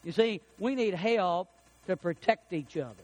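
usual speaking voice

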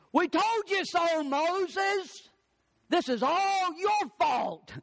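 high, slow, whining blame; nagging crowd voice, pitched high and drawn out; whiny complaining crowd. Acted. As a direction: pout and whine it like grumbling Israelites. Israelites blaming Moses, voiced.

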